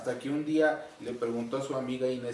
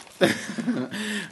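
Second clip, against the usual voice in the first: high, real burst of laughter; spontaneous amused laugh; 8 semitones higher than usual. Real, not acted. Host laughs at child's answer.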